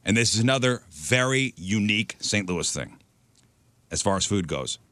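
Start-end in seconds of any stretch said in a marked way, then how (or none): none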